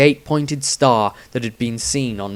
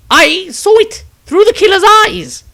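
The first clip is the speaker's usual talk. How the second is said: much higher, loud, desperate cry; strained wizard; 12 dB louder than usual. A narrator's reading. Weakened wizard defending himself, high desperate voice. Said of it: "so continued".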